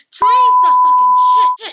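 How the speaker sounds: very high, drawn-out loud screech; exasperated shrieking command; desperate scream. Retold or imitated. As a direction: scream it slowly at the top of your range. Screaming at dying Tyler to drink.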